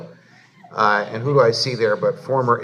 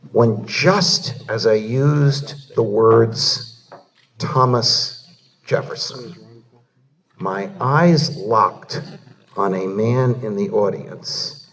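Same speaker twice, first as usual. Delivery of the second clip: slow, weighty storytelling with long pauses; hushed suspense, slowly; slow dramatic build; slower than usual. Real, not acted. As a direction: draw out every pause before the reveal. Dramatic reveal of his hero.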